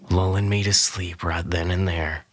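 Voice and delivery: usual speaking voice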